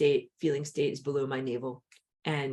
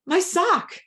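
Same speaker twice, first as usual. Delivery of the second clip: put-on high, whiny little-kid voice, much louder; whiny toddler; 8 dB louder than usual. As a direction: pout it out like a crying three-year-old. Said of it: "Be like".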